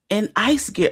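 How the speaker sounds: ordinary talk, nothing marked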